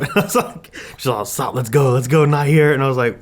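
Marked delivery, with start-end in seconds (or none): 1.2-2.7 higher, louder imitation of her begging; urgent retold plea, played for laughs; mock pleading. Boyfriend mimicking his tearful girlfriend, urgent mock pleading. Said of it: "she started tearing up".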